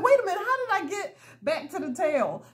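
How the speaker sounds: high-pitched, amused bewilderment; baffled. Retold or imitated. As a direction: retell it as a puzzled, high exclamation. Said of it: "I was just like".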